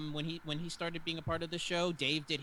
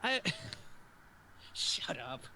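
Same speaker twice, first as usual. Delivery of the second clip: laughing; 7 semitones higher than usual. Real, not acted. Laughing at Dave's joke.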